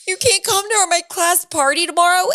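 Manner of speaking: put-on high, loud, upset children's pleading; upset kids' voices. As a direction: mimic the little boys on the verge of tears. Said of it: "And they're crying".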